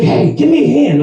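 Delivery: high mock plea for help; imitating the customer. Wheelchair customer asks the bartender, high mock request. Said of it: "And he's like".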